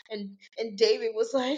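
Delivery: usual speaking voice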